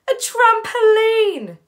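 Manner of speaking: huge, high, drawn-out announcement; excited brother. Acted. Brother Flynn revealing the kids' surprise, shouted excitement. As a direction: trumpet the big reveal, stretching every syllable.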